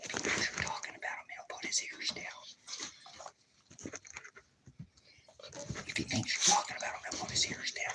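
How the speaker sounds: real hushed whisper; whispered aside. Real, not acted. Telling viewers about the dog's ears, whispered aside. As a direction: whisper it so the dog won't notice. Secret aside so dog won't react.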